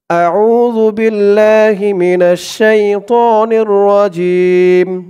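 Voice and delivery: slow melodic tajweed chant, drawn-out vowels; Quran recitation. Shown as a demonstration. Teacher recites the verse for viewers, in slow melodic chant. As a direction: recite it like tilawat, stretching every long vowel.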